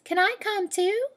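loon voice. Acted. The loon begging to join, high bird voice. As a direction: pipe it up like a little loon. Loon asks, much higher voice.

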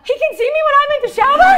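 high, loud, fast shocked laugh. Retold or imitated. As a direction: blurt it out in laughing alarm. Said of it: "And I go".

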